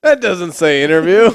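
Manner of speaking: loud, disbelieving protest; can't believe that's the word; laughing disbelief at the handwriting; incredulous. Real, not acted. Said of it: "disbelief at the misreading".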